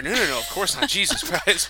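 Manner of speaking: loud, high bro voice; frat bro. Pretend. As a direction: play a defensive dude bro. Acting bro boyfriend dialogue.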